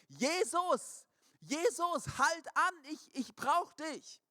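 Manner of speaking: high, loud, pleading cries; desperate shout. Retold or imitated. Blind beggar crying out to Jesus over the crowd, desperate shout. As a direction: call out over a crowd, begging. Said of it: "Und er schreit".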